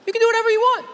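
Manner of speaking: a teasing, over-the-top burst of excitement; hyped exclamation. Shown as a demonstration. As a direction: sell it like an overexcited cheerleader. Repeats the line, then hypes it.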